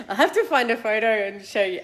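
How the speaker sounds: laughing. Real, not acted. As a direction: giggle the words out. Laughing about eighties hair.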